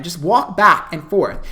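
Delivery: ordinary talk, nothing marked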